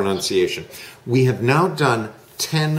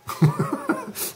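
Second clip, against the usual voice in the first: light laugh; 4 dB quieter than usual. Real, not acted.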